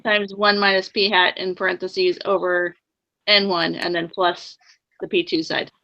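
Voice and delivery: dictating. Shown as a demonstration. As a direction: spell out each term deliberately. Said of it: "can you read that back to me".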